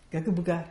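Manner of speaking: gagabuga said as a made-up babble word; nonsense word. Shown as a demonstration. Teacher tossing out a nonsense word. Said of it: "what if I say".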